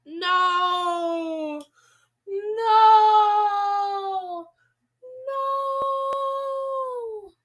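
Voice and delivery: loud, rising, sung-out cries of no; a long dramatic wail climbing each time; drawn-out wailing no. Real, not acted. Horrified reaction to waiter's story.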